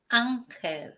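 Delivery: "Ángel" the Spanish way, AHN-hel; Spanish "Ángel", open A, stressed first; Spanish pronunciation. Shown as a demonstration. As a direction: say "Ángel" with a throaty h for the g. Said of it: "Whereas in Spanish".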